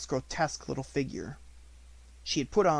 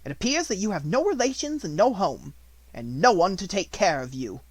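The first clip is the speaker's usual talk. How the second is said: louder, harsh headmistress voice; domineering schoolmistress, louder; stern Miss Minchin; 6 semitones higher, 6 dB louder than usual. A narrator's reading. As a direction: order it coldly.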